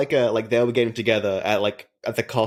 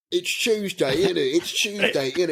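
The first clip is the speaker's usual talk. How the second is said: higher, exaggerated Cockney Statham impression; mock Cockney; 8 semitones higher than usual. Mates mocking Statham's Cockney accent in a thick Cockney voice. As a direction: lay the Cockney on thick, innit. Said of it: "just going".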